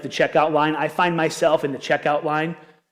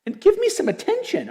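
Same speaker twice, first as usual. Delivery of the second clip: much higher pleading pup voice; the ignored dog whining for notice; family dog begging while the TV is on; dog's plea; 13 semitones higher, slower than usual. Retold or imitated. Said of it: "our dog is like".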